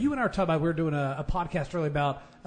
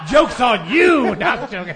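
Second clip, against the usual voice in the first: laughing; 6 semitones higher, 12 dB louder, slower than usual. Real, not acted. Laughing tease at the group.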